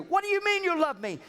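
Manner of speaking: high, incredulous wife; mock wife retort. Wife snapping back at husband, high mock disbelief. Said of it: "You say".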